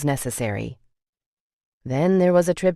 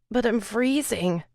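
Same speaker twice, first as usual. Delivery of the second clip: feverish plea; 8 semitones higher than usual. A narrator's reading. Sick feverish woman pleading.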